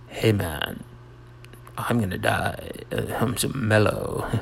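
laid-back, understated slacker delivery; mellow. Acted. Comic mistaking melodramatic for mellow drama, mellow. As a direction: announce your death as if totally unbothered. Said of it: "melodramatic is like".

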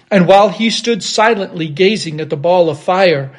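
usual speaking voice

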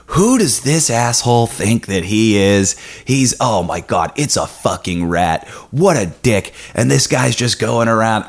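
hammed-up disbelief and contempt; mock outrage. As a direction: imitate scornful radio hosts tearing into someone. Radio hosts mocking him, retold.

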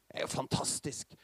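hushed, marvelling whisper; awed whisper. Real, not acted. Preacher marvels at the verse.

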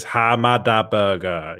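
slow, drawn-out catchphrase voice; mimicked catchphrase. Host reading Prince Zodiac's chat message, drawn-out mimicry. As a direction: stretch each syllable like the catchphrase clip. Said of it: "Prince Zodiac says".

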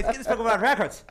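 lightly voiced rowdy drunk dwarf; mock-drunk dwarf calling out, a little high and loud. Drunk dwarf accosting the records man, mock rowdy shout. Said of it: "he is, he is hammered".

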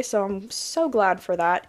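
normal talking voice

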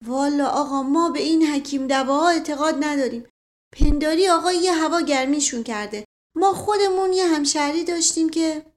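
stubborn loyal servant. Acted. Voices Mashghasem, the servant.

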